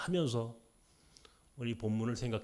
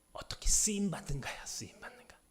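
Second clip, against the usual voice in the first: soft whisper fading away; hushed, fading whisper; 3 semitones higher, 4 dB quieter than usual. Acted. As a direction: let it fall to a whisper. Whispered key point.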